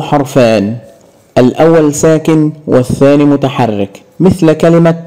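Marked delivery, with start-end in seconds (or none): none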